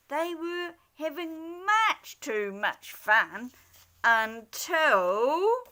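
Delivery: ordinary delivery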